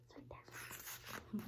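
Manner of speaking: soft breathy-free whisper. Real, not acted. Whispering to viewers about the supper. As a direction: hush it like a secret. Whispered aside pointing at picture.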